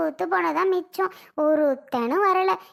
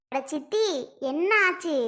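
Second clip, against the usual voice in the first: ordinary talk, nothing marked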